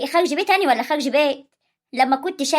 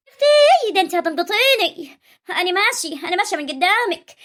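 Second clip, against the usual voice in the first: exasperated outburst; 4 semitones higher than usual. Acted.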